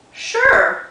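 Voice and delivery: high, loud, eager yes; eager rabbit. Acted. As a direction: answer like an excited bunny. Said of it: "said Rabbit".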